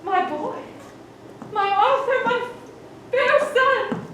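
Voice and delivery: rising from plain to a high, loud wail; building to a wail. Acted. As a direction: build each name into a scream. Constance mourning Arthur.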